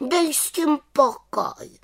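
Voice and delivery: very high, slow, cross retort; grumpy little witch. A narrator's reading. Little witch tells the nagging raven to stop, grumpily. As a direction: brush it off crossly, high and drawn-out. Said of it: "vykřikla mrzutě".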